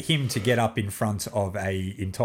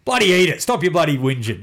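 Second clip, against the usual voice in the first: mock dad telling off; 5 semitones higher, 5 dB louder than usual. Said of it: "You know?".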